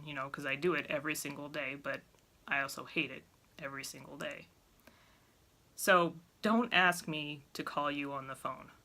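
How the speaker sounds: ordinary talk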